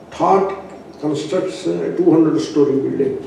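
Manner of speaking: ordinary talk, nothing marked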